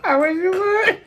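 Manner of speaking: loud, high I wish you would; daring challenge. Real, not acted. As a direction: dare him, loud and high. Wife daring him.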